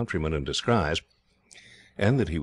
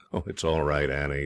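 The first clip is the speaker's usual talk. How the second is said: usual speaking voice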